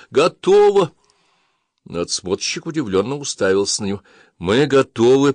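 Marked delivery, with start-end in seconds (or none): 0.1-0.9 loud, high triumphant shout. A narrator's reading. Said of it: "вскочил и заорал". 4.4-5.3 loud repeat. A narrator's reading. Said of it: "повторил Андер".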